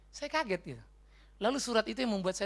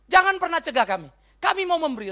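insistent plea; 9 semitones higher, 9 dB louder than usual. Retold or imitated. Macedonians insist on giving.

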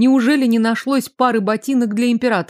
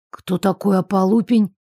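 ordinary delivery

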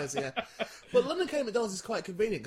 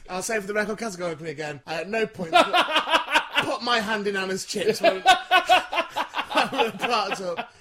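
louder, giggling denial; laughing; 3 semitones higher, 8 dB louder than usual. Real, not acted. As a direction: try to deny it through laughter.

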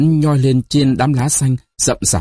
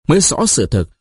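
plain reading voice

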